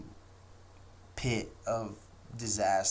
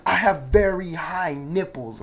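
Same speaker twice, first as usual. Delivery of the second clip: Karen insisting; 8 semitones higher, 9 dB louder than usual. Retold or imitated. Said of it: "she's like".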